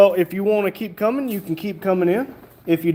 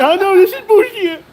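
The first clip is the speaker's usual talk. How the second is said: high, loud laughing protest; playful outrage, loud and high; indignant shout; 12 semitones higher, 8 dB louder than usual. Real, not acted. Streamer loudly protesting the chat's teasing about her height. Mock outrage after being teased.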